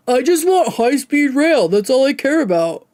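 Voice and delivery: mocking Hassan. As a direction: whine it out as if it's the only thing that matters. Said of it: "that is straight out of Hassan's back pocket".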